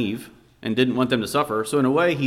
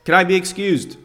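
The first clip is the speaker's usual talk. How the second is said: brother blurts it out higher, stated not asked; impatient boy announcing rather than asking; emphatic demand; 6 semitones higher, 3 dB louder than usual. Retold or imitated. Boy at supper demanding to leave the table, emphatic. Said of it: "emphatically states, rather than asks".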